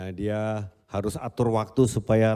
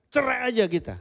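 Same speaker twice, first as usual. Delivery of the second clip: high, heated demand for divorce; heated outburst; 11 semitones higher than usual. Retold or imitated. Spouse blurts out divorce during a quarrel. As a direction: snap it out in a fight. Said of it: "Begitu gampang kita ngomong begitu".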